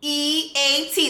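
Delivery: pointed slow spelling for the chat; spelled out slowly. Shown as a demonstration. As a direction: spell each letter out, drawn out. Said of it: "does anybody in the chat wanna spell it out?".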